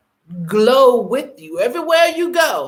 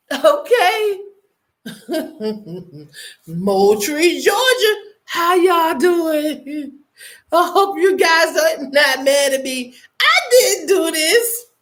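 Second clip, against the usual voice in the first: laughing to tears; 3 semitones higher than usual. Real, not acted. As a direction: crack up until your voice breaks. Laughing till crying at news.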